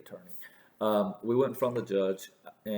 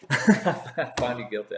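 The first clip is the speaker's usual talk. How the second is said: slow, loud mocking echo of the judge's verdict; 2 semitones higher, 7 dB louder, slower than usual. Retelling the judge's ruling, mocking it slowly and loudly. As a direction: drag it out like a pompous judge.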